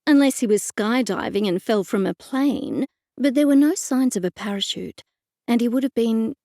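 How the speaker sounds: plain reading voice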